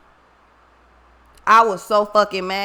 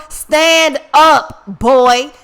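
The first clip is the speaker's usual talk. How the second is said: louder, more insistent shout of Stand up; louder call; 5 dB louder, much slower than usual. Retold or imitated. Repeating the call to the panicking brother, louder and more urgent. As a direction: bark the order again, harder. Calling to drowning brother again.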